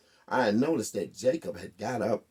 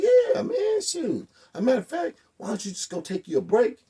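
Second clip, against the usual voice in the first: much higher, louder eager schmoozing; butter him up, overeager; eager sucking up; 11 semitones higher, 4 dB louder than usual. Retold or imitated. Teller sweet-talks the big inmate, much higher and eager.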